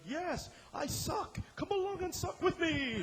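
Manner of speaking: high, drawn-out mock-triumphant cry; exuberant, hammed-up showman's holler; gleeful embrace of failure. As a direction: proudly proclaim it like a rallying call. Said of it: "because he's like".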